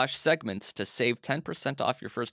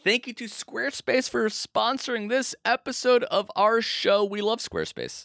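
ad read; 12 semitones higher than usual. Acted. Sponsor read.

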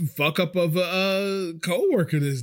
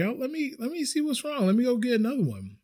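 high, softened customer-service apology; apologetic server; 9 semitones higher than usual. Retold or imitated. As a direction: imitate an eager apology, pitched well up. Acts out apologising to a guest.

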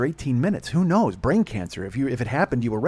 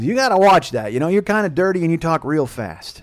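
loud, higher mom imitation; mock warning mother; 5 semitones higher, 8 dB louder than usual. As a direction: imitate a mother cautioning her grown son. Mother warning son, acted.

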